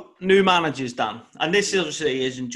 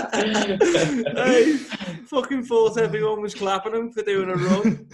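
laughing; 6 semitones higher, slower than usual. Real, not acted. Laughing while telling funny story.

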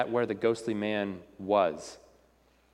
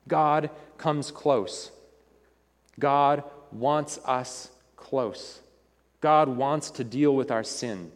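slow sermon close; slower than usual. Acted. Preaching the application.

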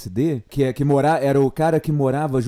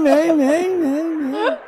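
high echoing repeats; echo effect; 10 semitones higher, 5 dB louder, much slower than usual. Pretend. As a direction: bounce the word back like an echo. Echo gag continues.

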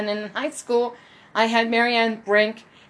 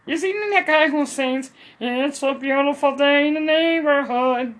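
lightly voiced cartoon mom, a bit higher; a hint of Lois asking about the show; Lois Griffin voice; 5 semitones higher, 4 dB louder than usual. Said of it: "I'm Lois Griffin".

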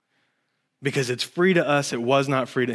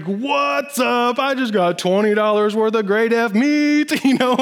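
high, bouncy gloating; giddy bragging; 9 semitones higher, 5 dB louder than usual. Retold or imitated. Preacher acting out showing off his free lunch, giddy. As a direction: boast like you won the lottery.

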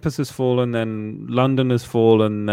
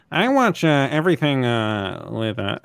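James. Retold or imitated. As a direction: mimic a hesitant speaker, dropping in pitch between uhs. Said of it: "let's do the reenactment of James doing that".

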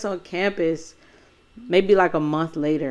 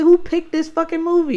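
loud, higher mock outburst of disgust; retold outrage; 10 semitones higher, 5 dB louder than usual. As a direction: yell it up high, fed up with the film. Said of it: "I was like".

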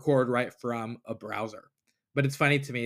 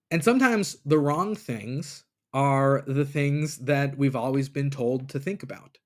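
usual speaking voice

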